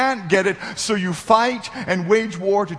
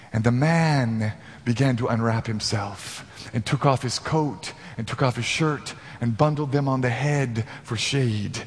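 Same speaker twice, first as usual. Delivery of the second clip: story narration; 4 semitones lower than usual. Acted. Tells a fable in sermon.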